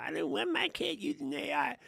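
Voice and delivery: very high-pitched mock of a fretful parent; squeaky put-on parent voice, making fun. Mocking parents who avoid AI.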